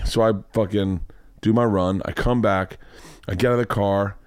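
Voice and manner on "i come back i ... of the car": normal talking voice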